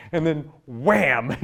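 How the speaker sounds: slow, punched "wham"; dramatic wham. Real, not acted. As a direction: drag out "wham" like a hit. Dramatic turn in story.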